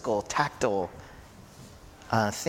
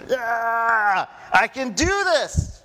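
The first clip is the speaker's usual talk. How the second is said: much higher and louder, full of adrenaline; pumped-up Peter; 11 semitones higher, 5 dB louder, much slower than usual. Retold or imitated. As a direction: hype yourself up like a man on adrenaline.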